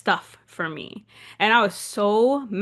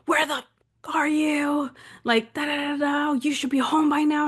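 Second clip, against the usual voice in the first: higher-pitched imitation of an angry father; mock dad tirade, pitched up; raging dad; 7 semitones higher than usual. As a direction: scold like a furious parent on the phone. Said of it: "My dad calls me raging".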